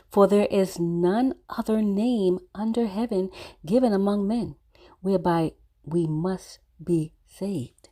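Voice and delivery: usual speaking voice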